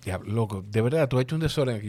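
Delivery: ordinary talk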